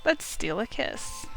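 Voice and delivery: usual speaking voice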